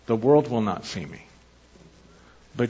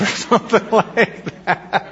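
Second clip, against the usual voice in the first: higher, louder, slow words through laughter; laughing; 8 semitones higher, 5 dB louder, slower than usual. Real, not acted. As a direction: laugh your way through the aside. Laughs at the child's quoted answer.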